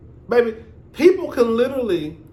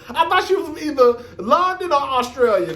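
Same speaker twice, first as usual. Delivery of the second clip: laughing; 5 semitones higher than usual. Real, not acted. Laughs at own confusion.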